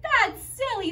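high, playful mock surprise. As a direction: pretend to catch a silly mistake. Playful teacher catching herself.

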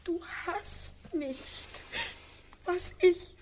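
wife crying out her grief at her husband; anguished outburst. Acted. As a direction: let the pain burst out. Childless wife breaks down.

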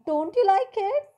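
high, loud surprised question; surprised creatures. A narrator's reading. Teacher voicing sea creatures, loud and surprised. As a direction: creatures amazed that the boy dislikes it. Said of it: "said all the creatures in surprise".

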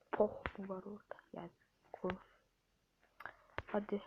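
soft whispered urging; hushed coaxing. Real, not acted. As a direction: whisper to the screen. Hushed muttering during attempt.

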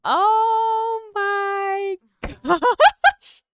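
high, loud, hugely stretched squeal; ecstatic scream. Real, not acted. Gamer seeing perfect photos of her Sims, squealing. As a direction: scream it out, drawing every word long. Thrilled by cute Sims moment.

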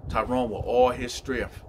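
ordinary delivery

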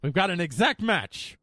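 drawn-out "and", then a loud, high announcement; game-show reveal. Pretend. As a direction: build suspense, then proclaim the result. Announcing the search result like a host.

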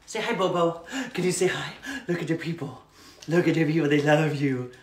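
cooing pet-talk voice. Real, not acted. Host coaxing her rescue dog to say hi, baby talk.

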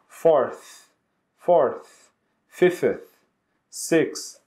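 Fourth, fifth, sixth with tongue behind teeth and a breathy th; th sound demo. Shown as a demonstration. Teacher showing the th sound, tongue behind teeth. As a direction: blow air on the th of fifth and sixth. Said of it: "dá uma sopradinha".

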